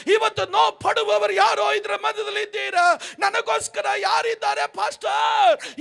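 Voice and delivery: fiery pastor exhorting the crowd; full-force preaching. Acted. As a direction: thunder it to the crowd.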